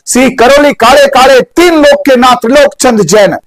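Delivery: slow, high, stretched answer line; slow teacher's drill; slow mnemonic recap. Shown as a demonstration. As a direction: spell the name out slowly for the class. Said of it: "slow mnemonic recap".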